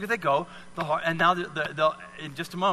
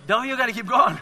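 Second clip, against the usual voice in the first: laughing urge; 8 semitones higher, 7 dB louder than usual. Real, not acted. Laughing while urging volunteer on.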